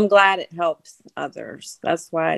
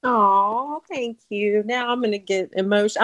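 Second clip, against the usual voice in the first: normal talking voice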